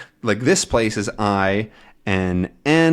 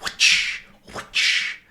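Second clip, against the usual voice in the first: whip sound. Shown as a demonstration. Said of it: "to flog is to whip".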